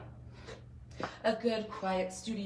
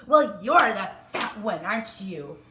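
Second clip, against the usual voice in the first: loud sneer; 9 dB louder than usual. Retold or imitated. The teacher loudly sneers back at the child. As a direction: bark it back cruelly.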